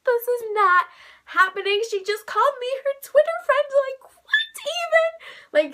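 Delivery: starstruck disbelief. Retold or imitated. Fan reliving being called a Twitter friend, starstruck disbelief. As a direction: squeal it in disbelief, stretching the end.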